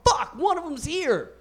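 sudden high-pitched alarm; mock panic. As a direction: burst out in comic dismay. Runner spots East African, breaks character.

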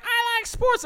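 high mocking girly squeak; mock the chick in a falsetto; mock girl. Bro mimicking a girl at the game, high falsetto. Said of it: "she's all like".

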